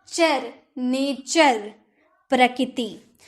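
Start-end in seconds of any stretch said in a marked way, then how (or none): 0.1-1.6 चर alone first, then the whole word नेचर; चर, नेचर spaced out slowly, both with "ch"; syllable drill, CH sound. Shown as a demonstration. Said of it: "नेटर नहीं".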